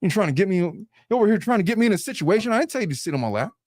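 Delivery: indignant, hurried mock protest; acted anger. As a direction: accuse her of setting you up. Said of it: "I bet you would be angry".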